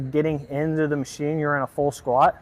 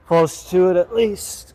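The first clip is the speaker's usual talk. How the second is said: higher, louder, slow and strained; lifting weights; 4 semitones higher, 5 dB louder, slower than usual. Real, not acted. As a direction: push the words out mid-rep. Talking while pressing the weight.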